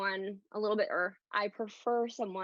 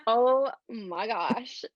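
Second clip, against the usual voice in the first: dramatic; 5 dB louder, much slower than usual. Real, not acted. Slow dramatic start, drawn out.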